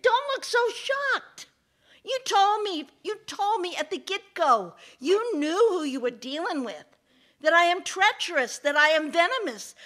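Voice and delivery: high, loud taunt; mocking snake. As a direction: throw it back at the betrayed victim. Gloating snake scolds the man.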